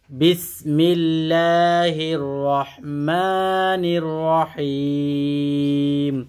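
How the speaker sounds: slow, drawn-out chanted recitation; Quran recitation. Shown as a demonstration. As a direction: recite with long melodic stretches. (ধীর সুরেলা কুরআন তিলাওয়াত)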